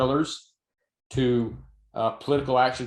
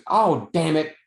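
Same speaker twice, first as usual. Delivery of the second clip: put-on little girl cursing; 4 semitones higher, 4 dB louder, slower than usual. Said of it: "And she goes".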